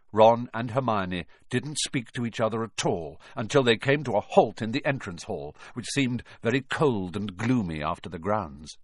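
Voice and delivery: ordinary delivery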